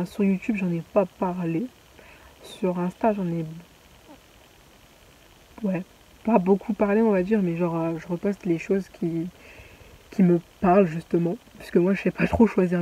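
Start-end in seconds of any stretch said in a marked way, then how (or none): none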